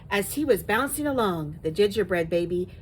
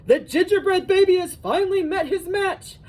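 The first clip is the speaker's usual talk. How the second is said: high, loud excited exclamation; big storybook outburst, pitched high; exclaiming; 11 semitones higher, 7 dB louder than usual. A narrator's reading. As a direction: cry it out as the amazed father. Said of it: "The father exclaimed".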